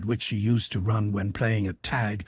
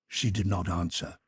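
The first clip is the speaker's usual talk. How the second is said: ordinary delivery, nothing marked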